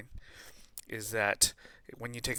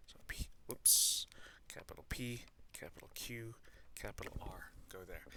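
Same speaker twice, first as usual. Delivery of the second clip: muttering to self; 2 semitones lower, 9 dB quieter than usual. Real, not acted. Aside while correcting board.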